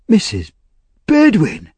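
startled call, jumping high on the surname; sudden cry. A narrator's reading. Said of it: "he cried suddenly".

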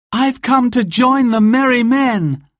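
put-on high, determined girl's voice; bold young woman. A narrator's reading. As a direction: announce it proudly. Marion voiced by narrator.

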